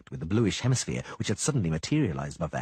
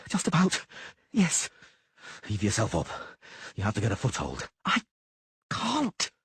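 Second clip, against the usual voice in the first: strained sorceress. Acted. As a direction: gasp it out while dangling. Yennefer dangling, straining.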